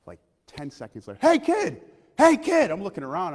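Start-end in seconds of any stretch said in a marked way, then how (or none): 1.2-2.7 high, loud call across a room. Retold or imitated. Coworker calling out across the workplace, loud and high. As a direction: shout to get the kid's attention.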